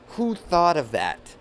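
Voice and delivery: high, loud disbelief; incredulous. Acted. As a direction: scoff at the very idea. Mocking the movie idea.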